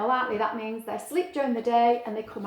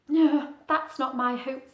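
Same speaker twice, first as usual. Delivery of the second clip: ordinary delivery, nothing marked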